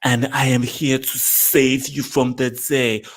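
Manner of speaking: mock bad acting, slow and laboured; drawn-out, hammed-up superhero declarations; Gal Gadot. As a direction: imitate a stilted heroine with a heavy accent. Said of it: "But then Gal Gadot said".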